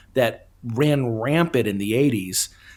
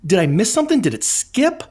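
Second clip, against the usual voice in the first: baffled disbelief; 7 semitones higher than usual. Retold or imitated. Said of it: "I was like".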